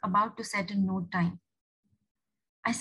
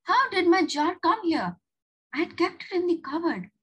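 higher-pitched baffled question; puzzled surprise; 8 semitones higher, 4 dB louder than usual. Acted. Julia spotting her jar on the window, baffled. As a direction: wonder aloud at a misplaced thing.

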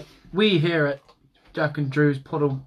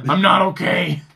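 louder mock New Yorker, insisting; New York accent; 4 semitones higher, 8 dB louder than usual. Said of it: "sound like a New Yorker".

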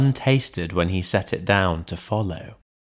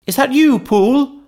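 much higher, louder frightened servant's call; voice from within; 5 dB louder than usual. A narrator's reading. Servant behind the chained door calling to the butler, high nervous call.

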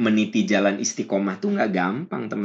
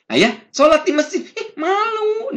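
suara tinggi merengek malu; 4 dB louder than usual. Retold or imitated. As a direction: rengekkan malu seperti suami enggan. Istri mengajak, suami malu. (suami merengek malu diajak ke masjid dengan suara tinggi)

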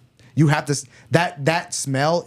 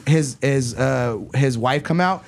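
ordinary delivery

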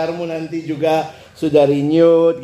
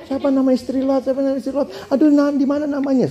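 nosy pestering; 9 semitones higher than usual. Retold or imitated. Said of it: "keponya tingkat dewa".

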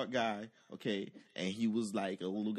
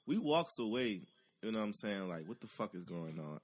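ordinary talk

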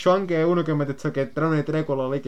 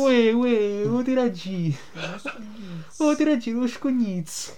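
mock Neapolitan accent; 6 semitones higher, slower than usual. Mimicking friend's Neapolitan.

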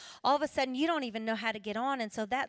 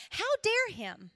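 indignant; 9 semitones higher, 5 dB louder, slower than usual. Retold or imitated. Indignant at killer's letter.

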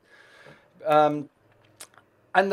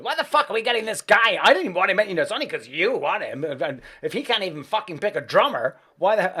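louder indignant rant; 3 semitones higher, 5 dB louder than usual. Retold or imitated. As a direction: blow up about the hired bassist.

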